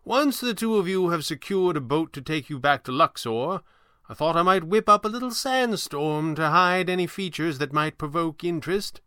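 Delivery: high, theatrical sorcerer, helpful; old stage magician. Acted. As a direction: offer help as the old magician. Voices the stranger Falcone.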